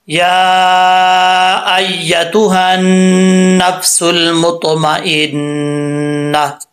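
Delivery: slow tajweed recitation; Quranic recitation. Shown as a demonstration. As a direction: recite the verse slowly and clearly. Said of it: "ये आयत है". (धीमी तिलावत के अंदाज़ में)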